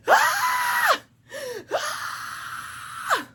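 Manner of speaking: shrill high fangirl shriek; excited scream. Retold or imitated. Retelling her own shriek at the scene, squealing. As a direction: squeal like an overexcited fan. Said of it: "And I'm like".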